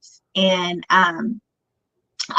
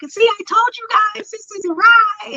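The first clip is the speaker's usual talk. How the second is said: much higher, tearful imitated protest; retold crying; 13 semitones higher than usual. Daughter to family after grandma's insult: high, tearful. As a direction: wail it out, pitched way up, in hurt protest. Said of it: "And I made me cry more".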